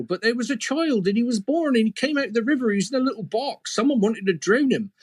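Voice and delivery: put-on high, excitable miller in local country accent; rustic local accent. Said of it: "this is an accent where I come from".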